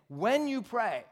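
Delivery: "when" punched high, falling at the end; emphatic WHEN. Shown as a demonstration. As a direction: hit "when" hard, pitched well up. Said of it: "did you notice he said, "When you pray"?".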